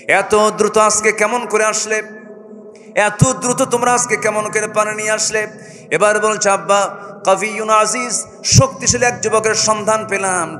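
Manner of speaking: high, loud sermon chant; full-force preaching. Acted. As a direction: preach it to the crowd.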